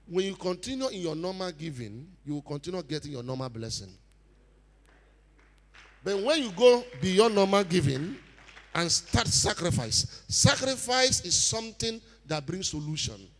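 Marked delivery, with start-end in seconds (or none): 6.0-13.3 preacher stretching out the key words; forceful preaching. Real, not acted. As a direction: drive each point home to the congregation.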